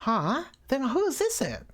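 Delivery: high, startled put-on voice; baffled character. Character wonders who it is, baffled. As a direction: ask it high and puzzled.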